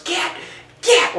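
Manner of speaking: high shouted shoo like to a dog. Retold or imitated. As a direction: chase the animal off. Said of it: "she goes".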